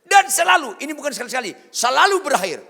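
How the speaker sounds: high, loud preacher's emphasis; emphatic shouting. Real, not acted. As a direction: hammer it home at full voice. Preacher hammering home the outcome.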